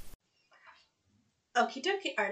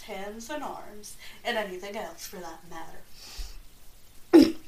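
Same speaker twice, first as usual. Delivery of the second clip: ordinary delivery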